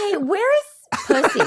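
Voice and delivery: high, laughing mock question; mock cheery question. Teasing the sister about the doll, high laughing question. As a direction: tease the sister with a bright question.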